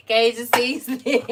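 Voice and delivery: ordinary delivery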